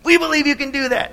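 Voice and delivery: high drawn-out yell, easing down; cheering crowd. Acted. Crowd urging on the walk with a passenger, excited shouting. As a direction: cheer him on with full confidence. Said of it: "And they say".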